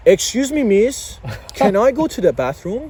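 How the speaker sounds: put-on high, loud Persian-accented kid voice; thick Persian accent. Year-four boy asking his teacher, thick Persian accent. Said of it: "Thick, like, Persian accent".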